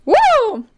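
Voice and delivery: loud high "woo"; sudden loud, high cheer; whoop. Real, not acted. Cheers in podcast banter.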